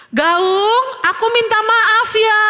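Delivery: high, drawn-out call of apology. A narrator's reading. Theo calls sorry to the echo, calling out. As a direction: call it across a valley.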